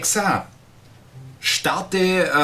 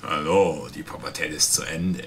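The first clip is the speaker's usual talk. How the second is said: low, deep trailer announcer voice; movie trailer voice; 9 semitones lower, 3 dB quieter than usual. Acted. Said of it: "diese typische Trailer-Stimme".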